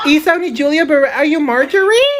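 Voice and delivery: fake American accent. Kid mangles celebrity name.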